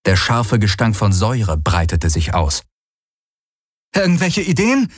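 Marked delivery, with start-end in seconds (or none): 3.9-4.9 high, urgent called question. A narrator's reading. Companion asks hero for a plan, calling out. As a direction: call over the fighting, pitched up. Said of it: "rief Kirai".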